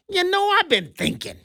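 puts on a high, chummy voice for the EU president; he mimics the EU president with a mocking high voice; mock von der Leyen. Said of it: "sounded something like this, of course, with her European accent, but I'm gonna translate it".

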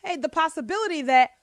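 loud, high, fervent preaching. Acted. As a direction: preach it like a sermon. Preaching on parenting at full force.

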